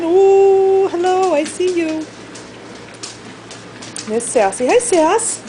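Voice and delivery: high sing-song greeting to pets; pet talk. Real, not acted. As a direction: coo at the animals. Cooing to pets.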